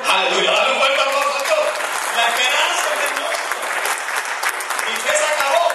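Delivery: high, loud shouted praise; fiery preaching. Acted. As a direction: lift the congregation with full-voiced preaching. Preaching at full force.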